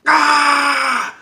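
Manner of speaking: high, loud mock Wookiee howl; Wookiee roar. Chewie roaring as Kylo kills his dad, loud howl. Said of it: "Chewie goes".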